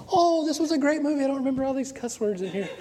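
high-pitched gushing with a laugh; high, comic self-quote; mock dismayed delight. Mock reaction rewatching old movie.

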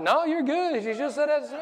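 high, loud mock-cheerful mom voice; mother reassuring. A mom telling the pastor it's fine, in a high bright voice. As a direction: brush it off like a friendly mom. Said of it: "the mom was like".

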